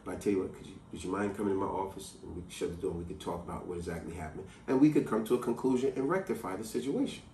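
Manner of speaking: low, soft, composed manager; professional. Retold or imitated. Manager inviting an upset customer into his office, professional and quiet. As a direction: imitate a calm, professional manager, voice dropped. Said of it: "very professional".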